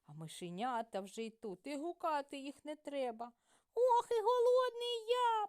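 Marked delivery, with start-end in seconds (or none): 3.7-5.5 very high, loud squeaky mouse voice; hungry mouse squeaking for food. A narrator's reading. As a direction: squeal with hunger like a tiny mouse. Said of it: "пищить круть".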